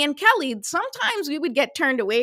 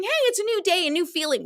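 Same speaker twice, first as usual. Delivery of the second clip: bright wake-up; 9 semitones higher, faster than usual. Pretend. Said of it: "might wake up saying".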